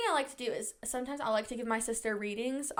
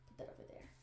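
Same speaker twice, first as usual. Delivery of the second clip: whispered aside; 23 dB quieter, faster than usual. Real, not acted. Aside while sorting.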